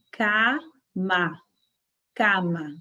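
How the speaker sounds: "cama" with both a's open; Spanish cama. Shown as a demonstration. Teacher leading the class in Spanish cama. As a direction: repeat "cama" open, no nasal. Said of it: "Pronunciem comigo".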